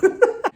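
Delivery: short, high laugh. Real, not acted. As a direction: burst out at your own joke. Laughs at lawyer joke.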